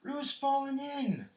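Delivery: high, loud, stretched-out shout; urgent high-pitched yell; alarmed cry. A narrator's reading. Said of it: "cried Rabbit".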